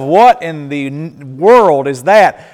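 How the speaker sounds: slow, loud, astonished outburst; stunned disbelief. Retold or imitated. Guest seeing chicken feet in the pot, slow loud astonished disbelief.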